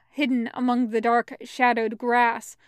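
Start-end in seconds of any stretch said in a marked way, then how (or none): none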